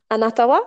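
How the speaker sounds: "Anata wa?" with a rising question tune at the end; question inflection. Retold or imitated. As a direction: ask "Anata wa?" as a real question, lifting "wa". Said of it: "like an inflection at the end, so it sounds like a question".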